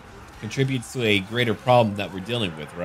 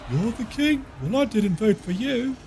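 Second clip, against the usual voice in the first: higher put-on voice of a cheeky peasant; mock peasant voice; 12 semitones higher than usual. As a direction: sass the king in a silly higher voice. Quoting a peasant comic scene.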